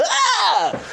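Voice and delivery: high mock scream as the gun is blasted away; a hammed-up yelp of surprise; retold scream. Henchman yelps when Superman lasers the gun away, mock scream. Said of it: "and I go".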